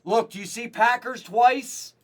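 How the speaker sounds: loud, high, drawn-out explanation; announcing loudly. Real, not acted. Explaining doubled teams to everybody watching, loud and high. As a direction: project each word slowly to everyone.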